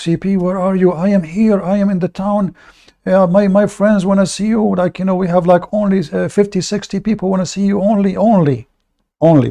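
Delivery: high, eager voice of a fan calling; mock caller. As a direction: imitate an excited caller bragging about numbers. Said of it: "they start making comments".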